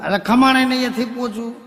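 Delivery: high, loud fed-up correction; exasperated. Acted. Husband correcting his wife, exasperated and loud. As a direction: cut her off, annoyed.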